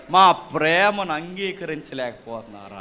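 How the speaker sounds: low, soft and slow, mimicking a whiny child; a preacher mimics the children's complaint, softly mocking; mocking quote. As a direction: parody their excuse in a small, slow voice.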